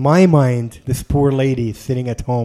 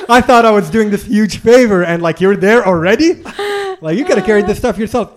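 high, loud, laughing mock protest; incredulous questioning; 7 semitones higher, 5 dB louder than usual. Traveler confronting ambassador about the huge favor, comically incredulous. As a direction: question him in exasperated disbelief, voice raised. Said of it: "I'm questioning things here".

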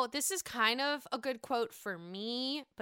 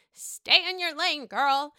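sassy; 5 semitones higher, 9 dB louder, slower than usual. Real, not acted. Sassy call-out.

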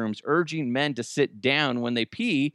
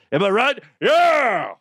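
loud, high mock cheer; hammed-up whoop of agreement; rallying cheer; 10 semitones higher, 8 dB louder than usual. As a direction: shout it like rallying the guys.

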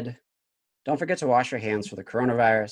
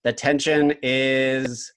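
dramatic reality show hype; 3 semitones lower, slower than usual. Acted. Host builds game-show suspense.

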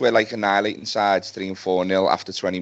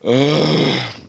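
long, loud, fed-up sigh; a fed-up fan sighs after a bad loss; heavy sigh; 4 semitones higher, 4 dB louder than usual. Real, not acted. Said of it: "That, that's how I'm feeling".

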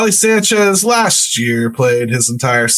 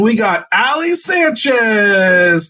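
drawn out, higher and slower, hammed-up hype; mock triumphant announcement; 7 semitones higher, much slower than usual. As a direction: announce it like a big trade in jest. Mock celebration of a minor signing.

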